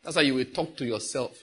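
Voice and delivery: ordinary delivery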